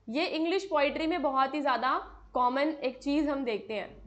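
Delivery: ordinary talk, nothing marked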